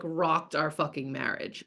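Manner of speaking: normal talking voice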